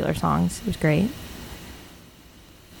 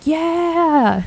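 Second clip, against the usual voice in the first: high yell along with the crowd; screaming; 11 semitones higher, 3 dB louder than usual. Retold or imitated. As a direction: cheer it out loud and high. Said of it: "screaming, like".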